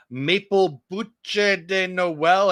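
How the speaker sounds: "bouche de Noël" slowly spelled out in a careful, unsure French attempt. Shown as a demonstration. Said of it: "I don't know how to say it".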